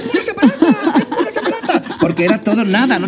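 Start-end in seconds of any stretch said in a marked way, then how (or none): 0.1-2.0 high, laughing shout of joy, fading to a breathy laugh; mock joyful shouting. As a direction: yell it out like a kid over the moon. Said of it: "me tiro un mes gritando por las calles".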